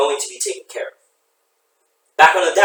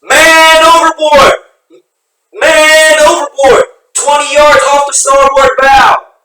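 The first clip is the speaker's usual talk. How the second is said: long drawn-out scream; man overboard shout; 12 semitones higher, 13 dB louder, much slower than usual. Acted. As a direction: scream it slowly across the deck. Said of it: "Mancuso screams".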